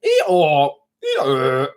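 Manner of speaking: a falling Eeyore bray, lightly imitated; a quick donkey-noise impression; mock donkey bray. Joking to viewers that his gasp sounded donkey-like, mock bray. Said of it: "it sounded like a donkey".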